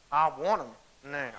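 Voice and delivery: put-on a touch of a child's teeth-clenched "now"; mild mock defiance, jaw tight; through clenched teeth. Girl mimicking her mom's teeth talking, lightly voiced. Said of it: "she clenches her teeth".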